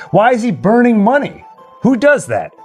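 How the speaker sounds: usual speaking voice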